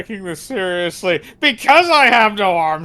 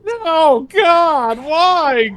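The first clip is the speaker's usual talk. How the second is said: high, loud wail of protest; loud melodramatic high pleading; anguished protest; 11 semitones higher, 6 dB louder, much slower than usual. Acted. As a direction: wail in hammed-up despair. Captive reacts to threat.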